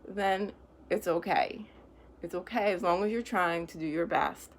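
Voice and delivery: ordinary delivery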